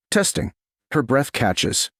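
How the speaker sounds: ordinary delivery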